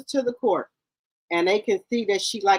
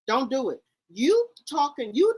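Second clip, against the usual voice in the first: normal talking voice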